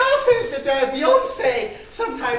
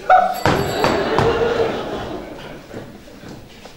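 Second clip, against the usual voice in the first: long breathy laugh; 5 semitones higher than usual. Acted. Laughs after own joke.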